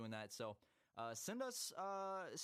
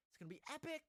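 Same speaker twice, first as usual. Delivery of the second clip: high hype voice; faster than usual. Pretend. Hyping episode fifty.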